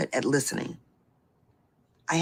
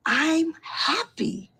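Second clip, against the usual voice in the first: happy; 12 semitones higher, much slower than usual. Real, not acted. Joyful description.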